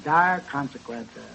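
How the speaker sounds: slow, low ominous repeat. Acted. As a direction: drop into doom.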